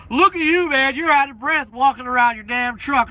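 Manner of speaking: loud high sneering voice; mocking a troll. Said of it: "One guy was like".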